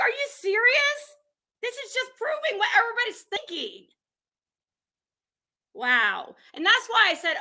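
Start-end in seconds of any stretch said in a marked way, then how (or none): 0.0-3.9 high-pitched mock disbelief; mock outrage. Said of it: "And it was like".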